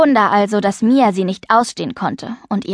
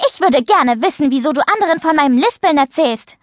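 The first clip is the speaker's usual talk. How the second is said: higher, agitated demand; upset, raised-pitch accusation; calling out accusingly; 6 semitones higher than usual. A narrator's reading. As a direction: confront a friend, voice pitched up. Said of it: "rief Mia".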